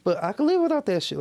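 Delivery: high, sing-song mockery of [name]; mocking [name]'s voice. Friend on a podcast mocking [name]'s two-faced words, high and sarcastic. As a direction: pitch it up and mock the contradiction. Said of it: "He, he even said that too. Like".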